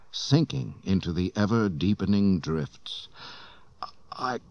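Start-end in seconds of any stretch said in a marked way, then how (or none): 4.1-4.4 high, strained plea of a freezing man; exhausted, high and strained; weak, failing voice. A narrator's reading. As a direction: barely get the words out. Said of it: "he said".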